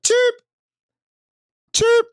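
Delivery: short, piercing high chirp, loud; imitated chirp. Streamer mimicking the battery chirp, loud high squeak. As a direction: squeak out single sharp beeps. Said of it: "Motherfucker said".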